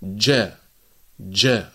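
ج said slowly, isolated; ج ببطء; jeem demo. Shown as a demonstration. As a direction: drill the stopped ج. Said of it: "فنقول".

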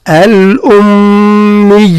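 الأمي slow, doubled mim held with nasal ghunna; nasal shadda. Shown as a demonstration. Teacher showing the ghunna of the mim. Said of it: "الميم ... والياء".

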